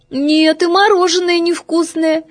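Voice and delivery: put-on little girl voice. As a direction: fuss like a picky little girl. Голос Феньки. (капризный высокий голосок девочки)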